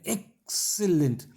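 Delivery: "what an" starts the climb, "excellent" peaks then falls; exaggerated tune demo on "what an excellent"; climbing intonation. Shown as a demonstration. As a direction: slowly lift the voice up to "excellent" and drop it. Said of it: "यहाँ पर चढ़ना शुरू होता है".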